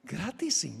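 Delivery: hushed, incredulous questions; softly dumbfounded, as the amazed debtor; acted stunned disbelief. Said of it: "Se le abren los ojos grandes".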